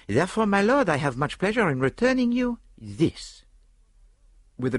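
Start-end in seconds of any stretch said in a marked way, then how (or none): 0.1-3.4 put-on higher, ceremonious French-accented detective; Poirot, triumphant. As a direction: present it with a French flourish. Poirot presents the diamond.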